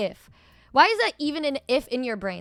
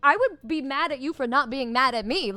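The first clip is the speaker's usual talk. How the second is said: ordinary talk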